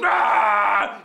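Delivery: long, loud imitated roar; mock yell. Comic imitating screaming stall neighbour, mock yell. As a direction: yell out a long wordless strain. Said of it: "he's all screaming and yelling".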